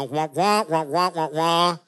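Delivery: Charlie Brown teacher's wah-wah; trombone-like adult voice, no words; muffled wah wah talk. Retold or imitated. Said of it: "the Charlie Brown adult speaking moment".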